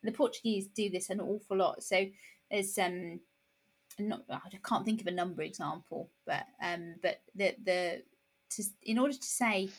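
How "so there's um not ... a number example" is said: normal talking voice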